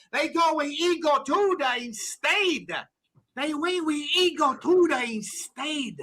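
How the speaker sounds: slow, hammed-up Italian accent like Conte. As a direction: drag out the Italian vowels, mock manager. Doing Conte impression.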